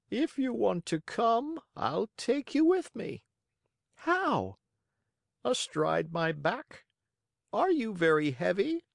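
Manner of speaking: high-pitched character dialogue; high wistful child, measured bird; pigeon and eager puppet. Acted. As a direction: voice both figures well above the narration.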